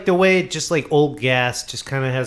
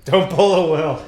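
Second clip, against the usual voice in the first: amused echo, slightly higher; laughing repeat; 4 semitones higher than usual. Real, not acted. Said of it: "Oh, that's funny".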